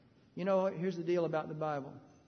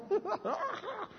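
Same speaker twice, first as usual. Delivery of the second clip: put-on high cackling laugh of an old woman; imitated old woman's laugh. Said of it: "Busted out laughing".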